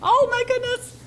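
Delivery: high, loud delighted gasp; delighted exclaim. Real, not acted. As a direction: gush with delight. Delighted reaction to the food.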